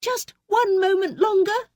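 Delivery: very high, pleading; high entreating cry. A narrator's reading. Wife entreating Bluebeard. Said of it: "entreated his wife".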